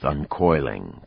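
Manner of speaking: usual speaking voice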